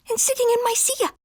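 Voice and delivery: very high, quick squeak; fourth little dog. A narrator's reading. Fourth amigo complains about his chair, high squeaky voice. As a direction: squeak it out fast. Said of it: "said the fourth".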